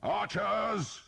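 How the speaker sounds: high, loud summons; shout. A narrator's reading. Earl calling his archers, shouted. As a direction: call the archers across the field. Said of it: "the Earl shouted".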